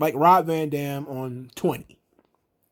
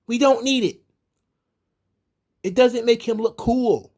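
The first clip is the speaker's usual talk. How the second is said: exasperated rant; 7 semitones higher than usual. Real, not acted. Fan ranting at the show's birds gimmick, high exasperated rant. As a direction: vent your disgust at the silly gimmick. Ranting about juvenile booking.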